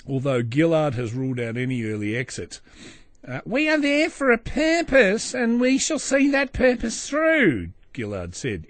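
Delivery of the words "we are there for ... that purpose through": high, loud put-on Australian accent, drawn out to the end; Gillard's accent. Quoting the prime minister on the war, mock Australian accent. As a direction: exaggerate an Australian politician's accent, pitched right up. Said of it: "in a very similar accent to that one".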